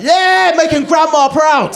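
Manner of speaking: high, drawn-out proud shout; rowdy cheering. Acted. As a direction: cheer them on at full voice.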